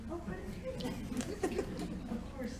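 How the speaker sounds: surprised, high and soft with a laugh; pleasantly surprised. Real, not acted. Lecturer reacting to her audience's show of hands, surprised. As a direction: draw it out in amused, high surprise. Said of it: "That surprises me".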